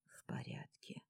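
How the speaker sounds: whispered to herself. Acted. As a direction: breathe it out unvoiced. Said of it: "repeats it to herself".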